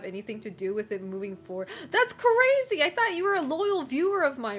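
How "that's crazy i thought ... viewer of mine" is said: high, loud playful outrage; mock offended. Streamer teasing a viewer who is leaving, mock offended. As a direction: feign shocked betrayal, pitched up then easing down.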